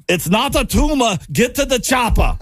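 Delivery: high, hammed-up Arnold voice; cheesy action-hero impression; terrible Arnold Schwarzenegger. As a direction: bark the movie lines like a bad Arnold. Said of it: "I'm doing a terrible impersonation of".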